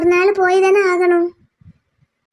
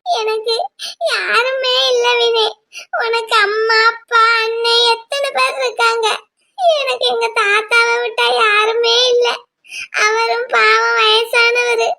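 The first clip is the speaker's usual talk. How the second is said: crying. Acted. Grieving girl to her friend, crying. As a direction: cry out the loneliness of losing her grandfather. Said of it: "அழுகாதல".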